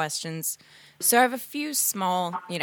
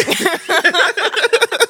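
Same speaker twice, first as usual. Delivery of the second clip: loud laugh; 11 semitones higher, 10 dB louder than usual. Real, not acted. Laughs after mock imitation.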